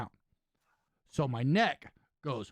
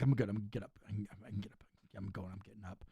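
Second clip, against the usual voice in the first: softer and softer mutter; fading self-talk; 7 semitones lower, 9 dB quieter, much faster than usual. Retold or imitated. Hurt wrestler telling himself to get up, fading mutter. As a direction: talk yourself up, fading away.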